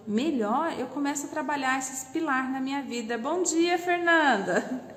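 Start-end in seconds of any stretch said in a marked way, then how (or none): 3.2-5.0 a warm hello broken by a laugh; laughing greeting. Real, not acted. (cumprimento rindo)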